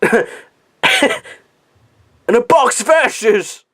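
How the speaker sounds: high, slow, drawn-out exclamation; mock villain rant. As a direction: stretch it out like a hammy movie villain. Parodies the same villain line.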